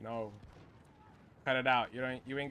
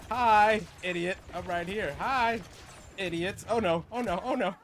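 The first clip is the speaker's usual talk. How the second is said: high, loud teasing greeting; loud taunting; 4 semitones higher, 6 dB louder than usual. Real, not acted. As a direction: bait the enemies with a cocky hello. Taunting enemies from hiding.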